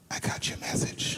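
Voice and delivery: breathy whisper, slow and close; whispered in his ear. Retold or imitated. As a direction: lean in and whisper it slowly. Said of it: "He said in my ear".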